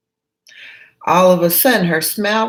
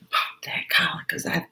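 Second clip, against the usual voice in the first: whisper; 7 dB quieter than usual. Real, not acted. Aside, whispered while adjusting.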